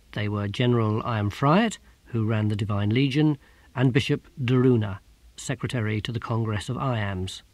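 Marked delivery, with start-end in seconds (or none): none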